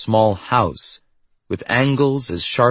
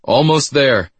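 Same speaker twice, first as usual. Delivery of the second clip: higher, louder call; raised, projected shout; yell; 6 semitones higher, 5 dB louder, slower than usual. A narrator's reading. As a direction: call it out to someone far off. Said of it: "the amnesiac yelled to her".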